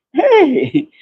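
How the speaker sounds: high bright call to the cat; calling out to the cat with a high hey; excited hey. Real, not acted. Greeting cat coming in.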